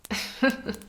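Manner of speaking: short teasing laugh; amused chuckle. Acted. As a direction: chuckle at your blushing partner. Amused at blushing wife.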